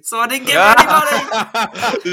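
laughing through the punchline; high, loud laughing words. Real, not acted. Cousin story punchline.

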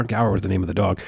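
normal talking voice

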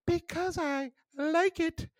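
very high, drawn-out mock-British girl's voice; mock British accent. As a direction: draw it out in a squeaky fake British accent. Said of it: "talking in a British accent".

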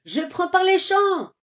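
high, loud shout. Acted. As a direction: yell out the plan while running. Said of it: "hurle Bellino". (Bellino hurle son plan de poursuite)

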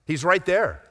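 ordinary delivery

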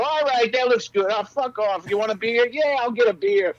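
high, loud put-on New York voices; brash crew banter. Crew members making up over beers, brash and loud. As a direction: toss it off like rowdy crew pals.

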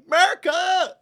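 high loud whooping "Merca!"; hammed-up eagle cheer; patriotic yell. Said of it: "and you're just like".

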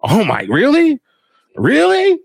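incredulous, rising to a high squeak; hammed-up shocked viewer; mock disbelief. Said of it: "I'm just like".

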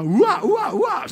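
high, loud hammed-up heaving; mock retching. Pastor throwing up during deliverance prayer, hammed-up retching. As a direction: act out vomiting in prayer.

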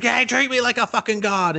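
high-pitched mock tantrum; indignant goddess. Self-proclaimed god scolding a boy who ignores her, indignant.

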